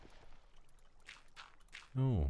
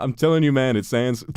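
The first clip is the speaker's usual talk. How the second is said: high, loud, insistent meme voice; imitating Uncle Aaron; 8 semitones higher, 12 dB louder than usual. Streamer quoting the Uncle Aaron meme, loud and high. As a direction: insist loudly, half joking. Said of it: "He's like".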